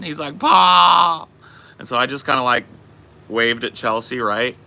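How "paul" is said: loud, high drawn-out cry; drunk T J. Retold or imitated. Drunk man calling after his departing friend, loud mock wail. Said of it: "describe a drunk T J".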